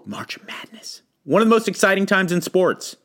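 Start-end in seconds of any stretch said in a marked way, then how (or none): 0.0-1.0 breathy dramatic whisper. Acted. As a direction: whisper it like a secret. Dramatic aside on sports.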